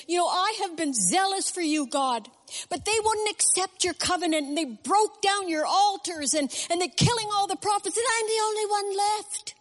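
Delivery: high-pitched, whiny outpouring of grievance; hammed-up wail of self-pity; aggrieved complaint. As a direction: pour out a complaint, pitch climbing to the end. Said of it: "out came his complaint".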